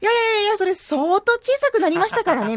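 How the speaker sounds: high, loud, hurried-on string of denials; acted protest. Host mimics the defence of the adapter's size, protesting. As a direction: play up an emphatic rebuttal. Acts out rebuttal to 'huge adapter' remark.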